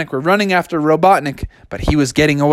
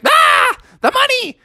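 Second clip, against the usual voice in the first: shocked shout. Acted. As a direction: yelp at the spilled money. Sonic sees money flying.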